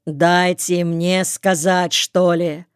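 usual speaking voice

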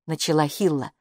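ordinary delivery

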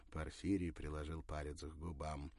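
usual speaking voice

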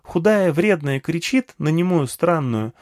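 put-on high, eager child voice; small girl eagerly addressing an old man; little girl. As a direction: pitch it up like a chatty little girl. Narrator voices little girl Nagma.

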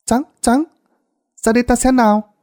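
put-on high, bright child calling out; playful child's call; calling the dog. A narrator's reading. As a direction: call the dog like an eager kid.

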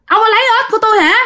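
high-pitched indignant outcry; crying out in alarm. A narrator's reading. Man accusing his companion of taking his frogs, high indignant outcry. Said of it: "ông Tư mới la làng lên".

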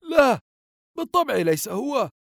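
high, emphatic dismissal; grandfather's acted disapproval; indignant denial. A narrator's reading. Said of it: "فقال الجد باستنكار".

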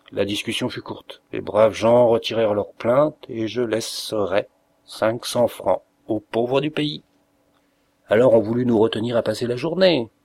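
ordinary delivery

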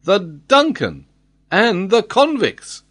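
high, drawn-out cry of dismay; exclaiming. A narrator's reading. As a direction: call it out slowly, aghast. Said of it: "exclaimed Glenarvan".

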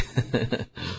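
low, soft chuckle; a short quiet laugh to himself. Real, not acted. Teacher chuckling after remarking on an unexpected theory lesson, soft laugh.

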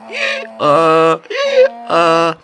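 loud braying hee-haw; donkey bray. A narrator's reading. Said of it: "выразительный ослиный рёв".

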